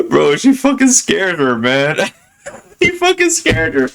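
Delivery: high, squeaky laughing speech. Real, not acted. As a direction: barely get it out through laughter. Cracking up at own story.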